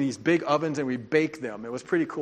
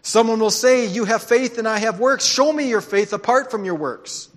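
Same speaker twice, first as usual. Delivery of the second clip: scripture reading; 4 dB louder than usual. Acted. Reads scripture aloud.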